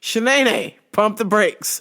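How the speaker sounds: high rising whoa to halt her; mock alarm. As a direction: hit the brakes on her, hammed up. Stopping the pushy customer.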